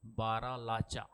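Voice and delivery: stretched out, syllable by syllable; teacher drilling Baralacha slowly; slow repeat for memorising. Shown as a demonstration. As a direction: say it slowly so students remember Baralacha. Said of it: "याद रखना है".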